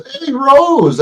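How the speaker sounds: loud, high sing-out hello; high-pitched greeting. Retold or imitated. Greeting Rose in her live chat, high-pitched call.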